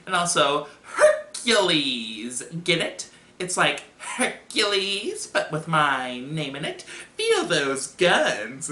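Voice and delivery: squeaky nerd showing off; slow, stretched-out squeaky boasting; milk the pun in an annoying nerd voice; Steve Urkel. Acted. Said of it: "this squeaky, annoying voice".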